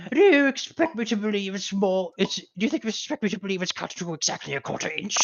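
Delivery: high, put-on nagging mother; mock indignant mom; scolding mother. Mother scolding son over lawn.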